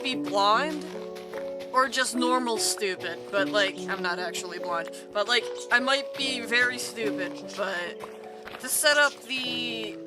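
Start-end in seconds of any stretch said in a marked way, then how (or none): none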